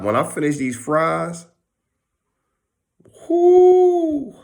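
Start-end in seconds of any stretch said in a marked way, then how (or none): 3.3-4.3 high loud whoo; whoo of relief. Pretend. Finishing the fries, a loud high whoo. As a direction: let out a big satisfied whoo.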